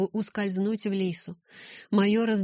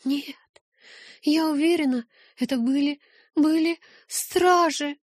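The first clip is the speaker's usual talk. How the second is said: desperate insistence; 9 semitones higher than usual. Acted. Rose insists, near tears.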